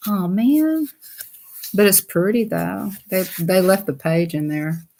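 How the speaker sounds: ordinary delivery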